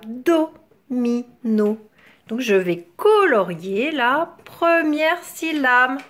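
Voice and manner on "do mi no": "Do, mi, no" as three isolated beats; split into syllables. Shown as a demonstration. Teacher listening for do, "Do, mi, no" broken apart. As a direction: pause between each part of "Do, mi, no". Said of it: "dans la première syllabe".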